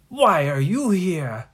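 loud, drawn-out wail of disbelief; hammed-up plaintive WHY at the start; astonished question. Startled captain questions the children.

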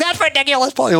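high-pitched, feisty imitation of a European accent; mock European accent. Customer scoffing at Paul's price rise, in a mock European accent. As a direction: ham up her European accent, indignant. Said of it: "took that, uh, accent and that energy".

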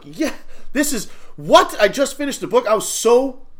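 high, loud shocked what; yelping in thrilled disbelief; excited disbelief. Retold or imitated. Kid told the class will watch the movie, shocked and thrilled.

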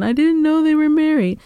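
high, innocent cheater's excuse voice; mock the classic "didn't know" line. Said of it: "that's the number one".

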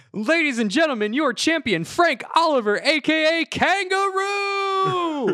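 high-pitched ring announcer introduction, last word stretched long; announcer yell. Acted. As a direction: bellow it like a fight announcer introducing the champion. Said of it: "We hear the announcer yell".

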